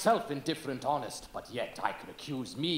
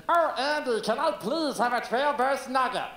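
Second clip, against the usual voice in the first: chipmunk voice; 9 semitones higher, 6 dB louder than usual. Retold or imitated. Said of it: "doing a chipmunk voice".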